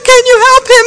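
usual speaking voice

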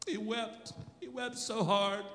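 high, choked-up telling; holding back real tears; tearful. Real, not acted. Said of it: "I can't help it".

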